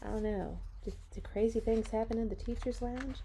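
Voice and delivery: usual speaking voice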